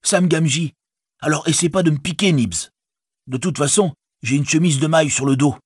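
high-pitched hobbit voice; Sam, hailing guards. A narrator's reading. Sam warns Nibs off with his pitchfork, calling. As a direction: call out to the guard, high and lively.

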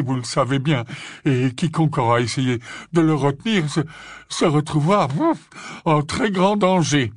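stammering, huffing flustered objection; panting. A narrator's reading. Slughorn objecting to Minerva's plan, panting and flustered. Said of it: "haleta-t-il".

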